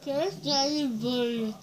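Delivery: cheeks stuffed with marshmallows; mumbled through a full mouth. Real, not acted.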